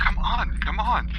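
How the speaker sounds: overheard urging voice. Acted. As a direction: coax a companion along from off-scene. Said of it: "this, this, voice".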